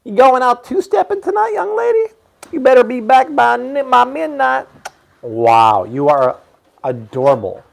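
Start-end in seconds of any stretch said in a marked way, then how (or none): 0.1-4.7 put-on high, loud Southern baby talk; mock-stern Southern parent, pitched way up; Southern accent attempt. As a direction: playfully scold in a high Southern twang. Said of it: "rolled their eyes at your Southern accent attempt".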